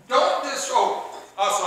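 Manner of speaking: ordinary talk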